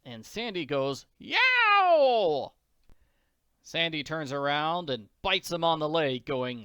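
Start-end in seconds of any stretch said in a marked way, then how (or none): 1.2-2.5 long, high, loud yowl; dog's pained yowl. Acted. Sandy as the Count lands on his tail, pained yowl. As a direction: howl like a dog with a trodden tail.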